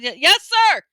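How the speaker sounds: high, loud, jumpy reply; startled yell. A narrator's reading. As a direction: yell back as if jolted upright. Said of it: "I yelled".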